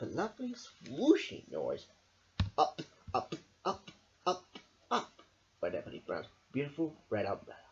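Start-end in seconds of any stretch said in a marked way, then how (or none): none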